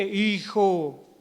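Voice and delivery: hammed-up divine summons; audible voice of God.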